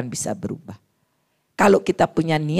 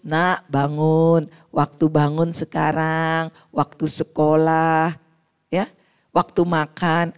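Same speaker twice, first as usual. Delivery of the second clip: gentle; 3 semitones lower, slower than usual. Acted. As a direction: coax the child awake tenderly, unhurried. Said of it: "dengan lus". (membangunkan anak dengan lembut dan pelan)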